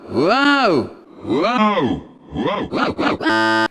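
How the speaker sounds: repeated mock whoas, fading. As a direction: ham up a wobbly rollercoaster whoa. Starting the ride, repeated whoa.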